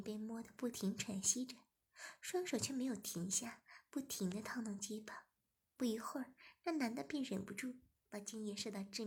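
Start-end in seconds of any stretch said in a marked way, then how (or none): none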